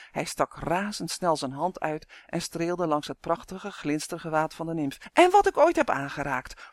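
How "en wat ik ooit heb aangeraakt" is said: high, loud boastful addition; proud. A narrator's reading. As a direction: add it with a proud flourish. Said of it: "voegde hij er trots aan toe". (trots en nadrukkelijk)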